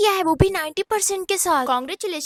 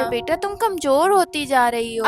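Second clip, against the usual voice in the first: normal talking voice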